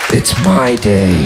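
low, slow deadpan "It's MY day"; groom's revenge. Retold or imitated. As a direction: drag it out low and smug, stressing my. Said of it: "And then the groom goes".

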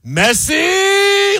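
high, drawn-out goal-commentator shout; Andres Cantor shout. Retold or imitated. Radio host imitates the commentator for listeners, long high shout. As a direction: belt it out and hold the last vowel high. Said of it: "you hear Andres Cantor shout".

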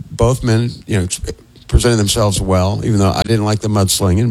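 usual speaking voice

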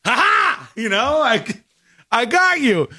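high, mock-triumphant gotcha; gloating cop. The cop gloats at Casey, high and mock-triumphant. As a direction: crow over catching the lie.

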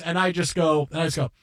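ordinary delivery

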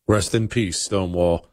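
ordinary talk, nothing marked

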